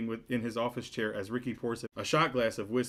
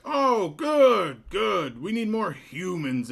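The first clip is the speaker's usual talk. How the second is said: very high, loud, stretched-out mayor voice; a tiny piping voice for a big man, dragging the name out; mismatched mayor voice; 8 semitones higher, 10 dB louder, much slower than usual. Acted. Mayor greeting Cliff, in a squeaky, booming voice. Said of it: "voice does not match his stature".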